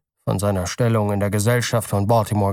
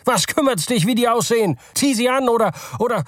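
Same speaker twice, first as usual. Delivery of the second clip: angry shout; 12 semitones higher than usual. Acted. Father yells at his old son, angry and high. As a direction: yell it at your son in fury.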